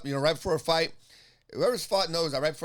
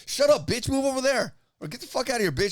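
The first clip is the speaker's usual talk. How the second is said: loud, higher re-enacted outburst; angry retort; 7 semitones higher, 4 dB louder than usual. Fighter telling off the heckler, angry retort. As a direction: lash back as in a heated argument.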